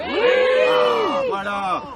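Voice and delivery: high excited cry of triumph; triumphant shout. Real, not acted. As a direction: burst out in triumph. Audience agrees, triumphant exclamation.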